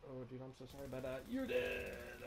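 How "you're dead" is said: high gloating shout; excited yell. Real, not acted. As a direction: crow over the kill. Yelling at downed enemy.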